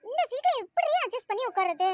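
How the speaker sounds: high, loud whine; dismayed complaint. Acted. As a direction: grumble in disbelief at the cramped seat. Girl whines about the single seat. (சத்தமாகச் சிணுங்கிப் புலம்புவது)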